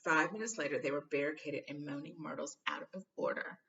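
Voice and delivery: usual speaking voice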